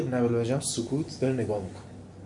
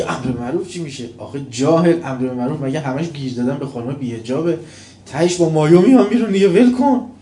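mocking scoffer; 3 semitones higher, 10 dB louder than usual.

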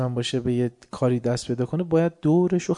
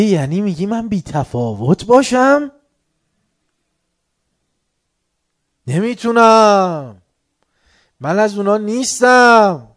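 loud, higher protesting voice; indignant complainer; 7 semitones higher, 10 dB louder, slower than usual. Retold or imitated. Preacher voicing complainer's loud indignant protest. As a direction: play the offended complainer, drawn out. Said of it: "میگه".

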